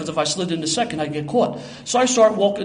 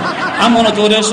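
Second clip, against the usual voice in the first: slow, laughing, louder insistence; 5 semitones higher, 9 dB louder than usual. Retold or imitated. Insists on his plan.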